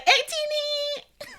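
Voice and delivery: high, slow, cooing and fond; lovingly high and drawn out. Retold or imitated. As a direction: coo the name like a proud fan. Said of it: "And now I'm just like".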